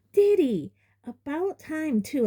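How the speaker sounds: high shrill-pitched doting mother; loud high greeting to her son; mother greeting her son at the door; Aunt Petunia. A narrator's reading.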